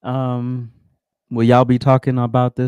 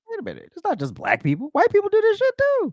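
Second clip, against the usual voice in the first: mock surprise. Mock realization quote.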